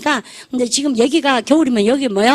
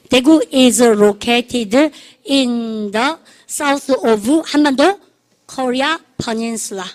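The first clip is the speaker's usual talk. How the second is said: drawn-out word-by-word model sentence; slow drill; much slower than usual. Shown as a demonstration. Teacher leading students through where Daegu is, slow drilled delivery. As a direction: stretch each word for students to follow.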